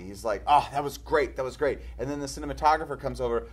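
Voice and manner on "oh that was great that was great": louder, delighted praise; happy director. Retold or imitated. Spielberg praising the take, louder and delighted. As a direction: beam over the take you just got. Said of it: "and he's so happy".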